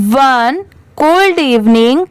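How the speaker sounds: very slow, word-by-word dictation; careful model reading. Shown as a demonstration. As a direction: read it out slowly for learners to repeat. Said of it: "अच्छे से read करके देखते". (धीरे-धीरे, शब्द-शब्द पढ़कर सुनाएँ)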